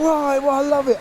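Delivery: high-pitched retold protest; protesting mate. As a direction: imitate a mate pleading his case, pitched high. Acting out mate's protest.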